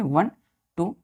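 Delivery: usual speaking voice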